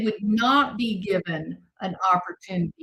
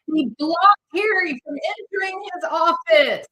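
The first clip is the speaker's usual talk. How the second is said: indignant, louder and higher; mock outrage; 7 semitones higher, 4 dB louder than usual. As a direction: overblown tabloid outrage. Mocking the press claim.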